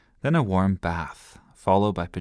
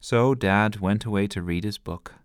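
usual speaking voice

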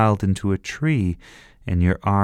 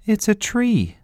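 put-on boy voice. A narrator's reading. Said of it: "he said".

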